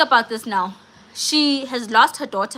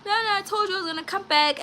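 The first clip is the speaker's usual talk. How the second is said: much higher, tearful mock pleading, fast at the end; retold crying plea; 8 semitones higher, 4 dB quieter, faster than usual. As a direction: imitate her sobbing plea to the landlord. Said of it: "she's like crying".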